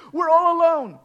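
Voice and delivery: loud, high cry of dread; fearful cry. Acted. Preacher voices great fear to congregation, high loud cry. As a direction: cry out as if stranded. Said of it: "position of great fear".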